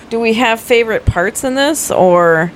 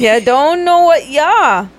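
put-on Scottish accent; 7 semitones higher, slower than usual. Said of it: "give your best Scottish accent".

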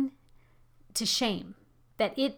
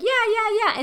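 much higher, brushing-off yeahs; dismissive yeah yeah; 10 semitones higher, 5 dB louder than usual. Retold or imitated. Imitating listeners who nod along and ignore her, mock dismissive. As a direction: wave it away without listening. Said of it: "you're like".

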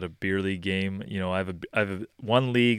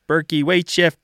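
put-on kid's higher, much louder call; imitated yell; 9 semitones higher, 10 dB louder than usual. Player calling down the bench to coach, imitated yell. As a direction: mimic a shout down the bench. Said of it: "someone will yell down the bench".

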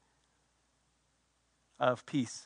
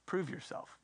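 barely voiced, intimate question; hushed question; 15 dB quieter than usual. Real, not acted. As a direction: drop to a near-whisper. Preacher lands a searching question softly.

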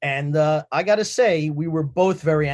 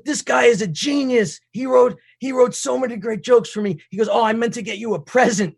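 gushing praise; 7 semitones higher, 4 dB louder than usual. Retold or imitated. Retold gushing Jimmy.

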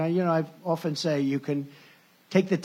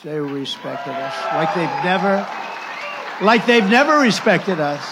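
building emphasis; 6 dB louder than usual. Real, not acted. Rally speaker to the crowd, building to a loud shout. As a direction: build it up, then hit never hard.

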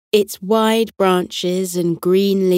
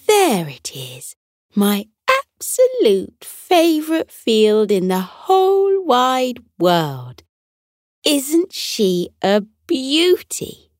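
drawn out delight; 6 semitones higher than usual. A narrator's reading. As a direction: gush over the field slowly. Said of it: "she sighed happily".

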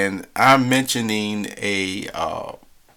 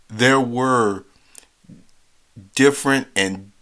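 ordinary talk

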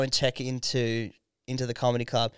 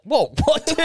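high, loud mock shock; imitating a friend hearing the rumour, high and shocked; shocked reaction; 13 semitones higher, 5 dB louder than usual. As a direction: jolt up in disbelief. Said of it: "You're like".

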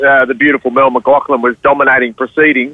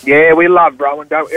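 ordinary delivery

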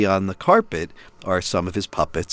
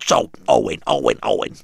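Gilbert Gottfried voice. Retold or imitated. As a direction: squawk it out as a cartoon parrot. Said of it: "It's Gilbert Gottfried's voice".

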